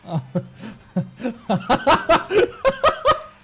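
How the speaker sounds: loud, high burst of laughter; real rising cackle of amusement; big laugh. Real, not acted. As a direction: laugh hard, climbing in pitch.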